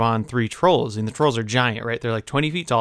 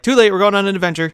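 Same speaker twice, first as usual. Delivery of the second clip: fast, loud and high; mock eager dwarves; 8 semitones higher, 6 dB louder, faster than usual. Dwarves overrule reluctant Bilbo, loud playful rush. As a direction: barrel over the objection excitedly. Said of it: "the dwarves are like".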